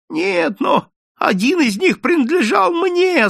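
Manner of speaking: high, pleading whine; whimpering. Acted. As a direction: whine to the shopkeeper for the goose. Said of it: "захныкал человечек". (хнычет жалобно, очень высоким голосом)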